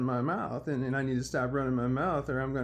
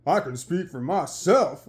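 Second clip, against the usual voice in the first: loud, slow put-on tough-guy voice; mock tough buddy; 3 semitones higher, 7 dB louder, slower than usual. Buddy answering whether his leader speaks for him, mock tough voice. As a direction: imitate him slowly and loudly. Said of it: "like that".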